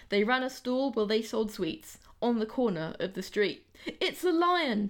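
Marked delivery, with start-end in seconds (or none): 4.0-4.9 high, loud alarmed cry; startled cry. A narrator's reading. Hen startled at her stall, crying out high. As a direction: cry out in fright at the lion. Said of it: "Penny cried".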